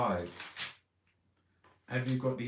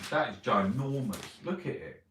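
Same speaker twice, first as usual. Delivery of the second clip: ordinary talk, nothing marked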